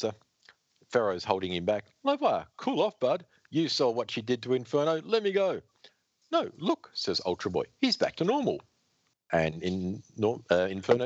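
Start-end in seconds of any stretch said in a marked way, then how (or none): 2.0-5.6 raised pitch, urgent restraining tone; high-pitched hero voice. Acted. GM voicing Pharaoh holding back. 6.3-6.9 sudden high cry; high excited voice. Acted. Ultra Boy voiced by GM. 7.8-8.6 high relieved voice. Acted. Ultra Boy voiced by GM.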